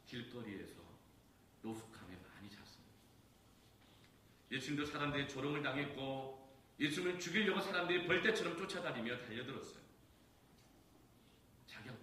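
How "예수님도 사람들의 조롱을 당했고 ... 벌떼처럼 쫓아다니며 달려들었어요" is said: high and loud preaching climax; fervent preaching. Acted. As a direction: build to a passionate peak. (높고 크게 절정으로 치닫는 설교)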